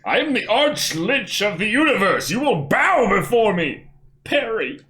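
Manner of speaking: booming evil necromancer voice, high and loud; dark lord. Pretend. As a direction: proclaim it like a lich lord.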